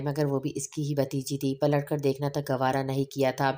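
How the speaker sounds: ordinary delivery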